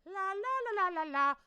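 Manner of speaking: dreamy high la-la; spacey sing-song. Pretend. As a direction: float off, spaced out. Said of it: "if I look a little like".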